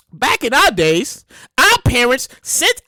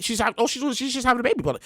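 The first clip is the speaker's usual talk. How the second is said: ordinary delivery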